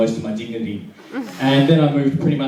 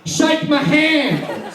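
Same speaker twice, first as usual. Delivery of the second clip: high, drawn-out mock drunken demand; drunk insistence; 9 semitones higher, much slower than usual. Drunk guy on Australia Day demanding a handshake, hammed-up insistence. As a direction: stretch each word, rising into a slurry yell. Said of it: "that one guy drunk".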